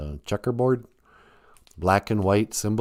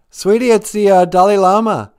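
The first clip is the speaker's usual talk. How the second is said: high, loud starstruck gush; loud high excited announcement; 11 semitones higher, 11 dB louder than usual. Pretend. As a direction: gush over the famous caller. Excited about famous caller.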